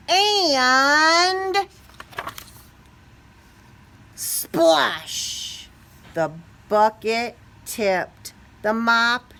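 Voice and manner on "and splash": dramatic splash. A narrator's reading. Climax of the crash.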